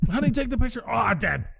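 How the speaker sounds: high-pitched mock death cry; hammed-up, high and silly; mocking imitation. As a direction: ridicule it in a high put-on voice. Mocking the hang-gliding death.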